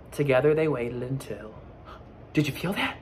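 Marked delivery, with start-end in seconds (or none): none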